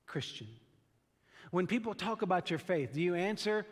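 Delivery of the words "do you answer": ordinary talk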